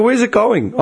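high, incredulous demand; exasperated question. Retold or imitated. Customer asking call centre where parcel goes, exasperated. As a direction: retell a baffled customer's question, pitched right up.

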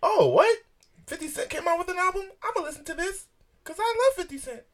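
put-on high squeaky child voice; little kid voice. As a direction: sound like an excited little boy. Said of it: "That's, that's my little kid voice".

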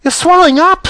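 very high-pitched panicked exclamation; horrified scream. Acted. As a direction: shriek it in motherly horror. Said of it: "screamed Missus Beauregard again".

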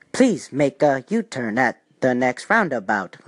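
slow, low robotic navigation voice; measured machine-like direction readout; early GPS voice. Retold or imitated. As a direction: intone it like an old computerized GPS, word by word. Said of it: "look at the voice evolution".

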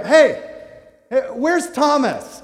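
loud, high, stretched-out call. Acted. Newcomers in heaven looking for Thomas, calling out. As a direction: holler for someone across a crowd.

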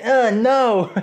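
sudden high, loud dismayed cry. Retold or imitated. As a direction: react as if the PC just died. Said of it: "and I was like".

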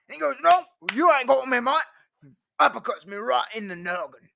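hammed-up Australian tale; Australian accent. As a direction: spin the yarn like an Aussie bloke. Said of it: "changed to Australian".

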